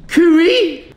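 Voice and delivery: long, high, loud coo-ee call; drawn-out, high-pitched holler. Real, not acted. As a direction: sing the name out high and loud. Playful shouted introduction of his friend.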